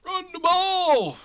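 long, high, loud holler; drawn-out yell like a rowdy fan; gleeful bellow. Acted. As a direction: shout it out, stretching every word. Yells out the Eagles' game plan.

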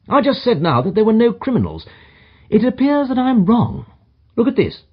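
high-pitched detective musing aloud; Holmes admitting error. A narrator's reading. Holmes corrects himself.

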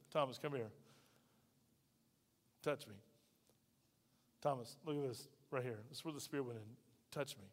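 very soft, low and gentle; tender invitation. Acted. Jesus gently inviting Thomas to touch his scars, very softly. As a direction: coax him closer, hushed and tender.

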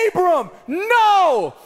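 a drawn-out high yell of the name, then no; wife catching her son in the act, shrieking his name; mock scream. Wife discovering the mess in the crib, a stretched mock shriek. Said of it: "I hear this blood-curdling scream".

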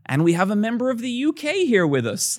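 high, loud showy introduction; American host mimicry. American introducing an Irishman as British, showy mock announcement. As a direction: announce it like a chirpy MC.

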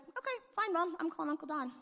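put-on high, quick sassy child; kid's comeback. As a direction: threaten mom like a cheeky kid. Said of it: "he's like".

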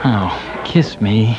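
normal talking voice

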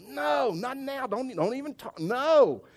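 very high, exasperated brush-off; short and rude. Retold or imitated. Husband cutting off his wife's approach, rude and exasperated. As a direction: cut someone off in hammed-up irritation. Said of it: "I was short and rude".

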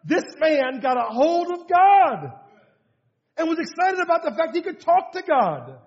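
loud, high, driving sermon climax; full-force preaching. Acted. Pastor rousing his church: loud, high, urgent preaching. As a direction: preach it hard to a sleepy congregation.

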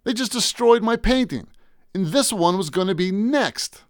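high-pitched, agitated outburst; frantic disbelief. Acted. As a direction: blurt it out in outraged disbelief.

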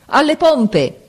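high, urgent captain's command; thundering shout. A narrator's reading. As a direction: shout the order across a storm. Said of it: "Una voce tonante gridò".

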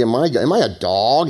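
ordinary talk

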